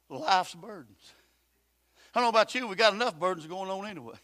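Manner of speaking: ordinary talk, nothing marked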